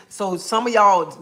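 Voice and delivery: ordinary delivery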